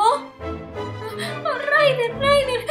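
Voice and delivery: calling out. Acted. Calling out in distress.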